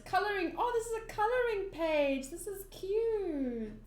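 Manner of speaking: high-pitched, pleased surprise, drawing out the last word; real cooing delight. Real, not acted. Reader discovers a coloring page, high cooing delight.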